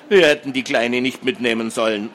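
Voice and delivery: plain reading voice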